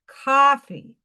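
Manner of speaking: coffee as "kawfee", rounded New York vowel; New York accent. Shown as a demonstration. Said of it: "I'm losing my New York accent".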